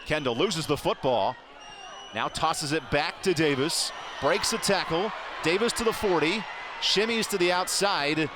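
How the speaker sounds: rising, excited live game call; play-by-play. Real, not acted. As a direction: call the run as it happens.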